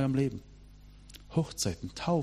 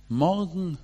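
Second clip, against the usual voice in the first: "Morgen" lifted higher, long and coaxing; devil's coaxing word; 7 semitones higher than usual. Retold or imitated. The devil tempting the churchgoer to put things off, "Morgen" pitched up and coaxing. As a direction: dangle "Morgen" like a sweet lure. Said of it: "Lieblingswort des Teufels".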